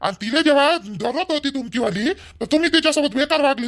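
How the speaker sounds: ordinary talk, nothing marked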